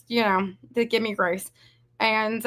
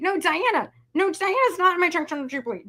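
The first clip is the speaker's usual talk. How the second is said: normal talking voice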